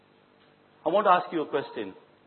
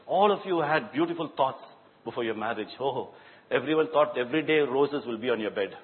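ordinary talk

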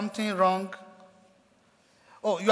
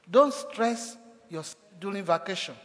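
usual speaking voice

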